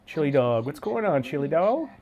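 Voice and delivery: usual speaking voice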